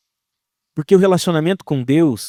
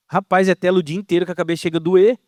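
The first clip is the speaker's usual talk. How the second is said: usual speaking voice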